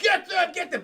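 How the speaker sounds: high, loud strangled order; mock savage command. Devolving villain sends the Goombas after them, loud mock command. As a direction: yell it like a villain turning savage.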